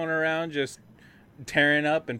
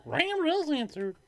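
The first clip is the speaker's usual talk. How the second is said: mock high voice; 3 dB quieter, much slower than usual. Mock echo of call.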